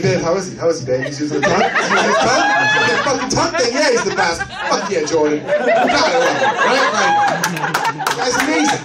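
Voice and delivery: a hammed-up fan cheering on his hero; to his girlfriend, over-the-top excited cheering; mock cheering.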